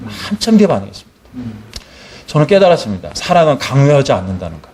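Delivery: ordinary delivery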